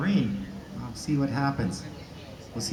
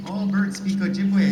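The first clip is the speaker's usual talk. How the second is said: louder and higher, said at normal speed; grandfather insisting; 7 semitones higher, 6 dB louder than usual. Retold or imitated. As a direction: repeat it with a raised voice, insisting. Grandfather's repeated claim.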